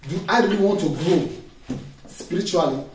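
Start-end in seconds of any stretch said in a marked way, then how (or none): none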